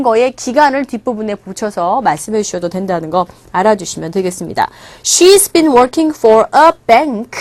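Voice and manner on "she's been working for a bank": very slow, clear model line; slow demo read. Shown as a demonstration. Teacher reading an example slowly to students. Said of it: "이렇게 얘기할 수".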